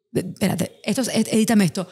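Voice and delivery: ordinary delivery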